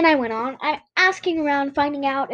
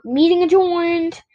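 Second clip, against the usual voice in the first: slow, raised announcement; formal announcement; 5 semitones higher, 4 dB louder, slower than usual. A narrator's reading. Club president ends meeting, slow and formal. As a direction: close the meeting officially. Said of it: "announced our president".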